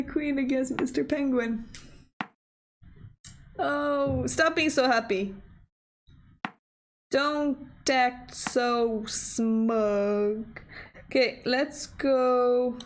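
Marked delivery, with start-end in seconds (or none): none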